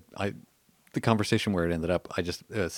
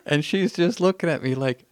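normal talking voice

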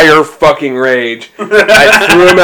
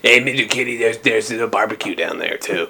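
much softer, hushed aside to the cat; soft teasing aside; mock hush; 4 semitones lower, 10 dB quieter than usual. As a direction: murmur it to the kitty.

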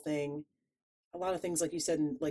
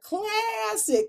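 very high, very slow classic; high drawn out; 4 dB louder than usual. Shown as a demonstration. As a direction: stretch classic high and long. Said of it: "but classic".

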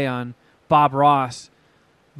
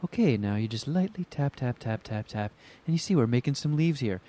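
soft, hushed gentle painter voice; much softer, dreamy TV painting-show murmur; soothing Bob Ross; 3 semitones lower, 7 dB quieter than usual. Retold or imitated. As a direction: coax it out softly as if calming a nervous student. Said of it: "did not have a soothing voice (contrast: Bob Ross gently)".

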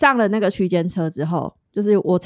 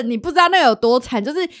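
ordinary delivery

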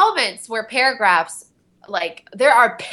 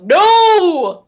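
loud, high cry of No!; frustrated shout; 9 semitones higher, 9 dB louder than usual. Shown as a demonstration. Actor showing how she reacts to a flubbed line, loud shout. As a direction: yell it like you just blew the take. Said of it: "I go, ... like that".

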